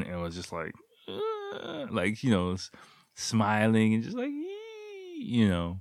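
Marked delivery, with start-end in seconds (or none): 1.1-1.9 much higher, tiny embarrassed "Uh"; shy girl squeak. Retold or imitated. Imitating girl's shy noise. 4.3-5.2 much higher, soft tight "Ee" squeal; embarrassed squeal. Retold or imitated. Imitating girl's shy squeal.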